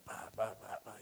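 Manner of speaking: soft unvoiced honking sounds; lightly voiced horn beeps; imitated car horn. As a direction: mimic a horn quietly, pa pa. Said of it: "intentar pitarle".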